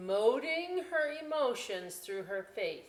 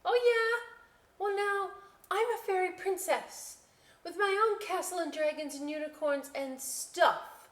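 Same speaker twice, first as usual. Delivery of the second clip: much higher, louder boasting; defiant pretend princess; 13 semitones higher, 5 dB louder than usual. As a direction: top the potato proudly.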